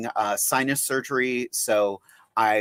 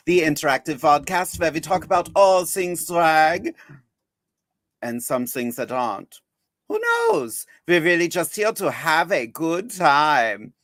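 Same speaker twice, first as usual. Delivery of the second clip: put-on lightly held German accent, slowing at the end; Frau Blucher; 2 semitones higher than usual. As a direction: keep the German housekeeper accent going, a squeal on the question. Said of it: "I'm not doing that accent all night".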